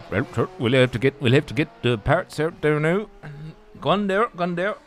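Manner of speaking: hammed-up West Country accent for an old pit mechanic. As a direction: yokel West Country burr. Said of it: "Why have you just gone West Country".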